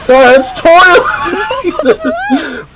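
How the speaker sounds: very high, very slow laughing cry; Bobby warning him, very high laughing squeal. Retold or imitated. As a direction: squeal it while cracking up. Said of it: "Bobby's like".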